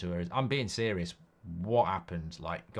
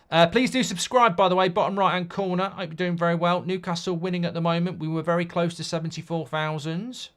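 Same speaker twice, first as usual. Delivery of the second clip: upbeat streamer plug; 6 semitones higher, 5 dB louder than usual. Real, not acted. Channel outro plug.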